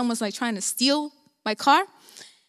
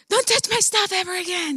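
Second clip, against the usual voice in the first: higher-pitched angry warning, re-enacted; retold anger; 6 semitones higher than usual. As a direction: replay telling mom off, voice raised in pitch. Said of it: "I was so angry".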